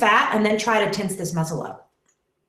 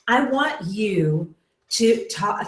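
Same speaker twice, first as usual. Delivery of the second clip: ordinary talk, nothing marked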